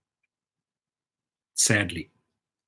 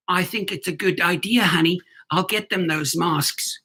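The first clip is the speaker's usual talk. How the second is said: mock husband voice; 4 semitones higher than usual. Acted husband answering.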